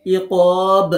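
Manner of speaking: ইকব with a hard bounced ব at the end; qalqala bounce. Shown as a demonstration. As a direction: drop the ব with a push. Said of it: "ধাক্কা দিতে হবে, drop দিন".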